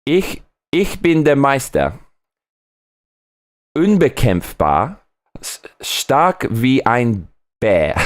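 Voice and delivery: slow, solemn German proclamation; German motto. Shown as a demonstration. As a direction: declaim the German saying slowly, like a grand motto. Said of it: "I have to say this in German".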